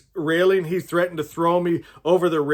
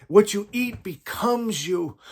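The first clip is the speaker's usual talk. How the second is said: ordinary talk, nothing marked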